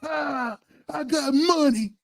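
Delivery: high, very slow rap ad-lib. Retold or imitated. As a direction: stretch it out like a rap hype line. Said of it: "I come in listening to [name]".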